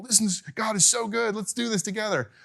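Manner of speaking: high, light voice of wonder; awed worshipper. Acted. Voicing awed crowd at temple.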